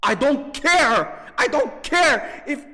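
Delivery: ordinary delivery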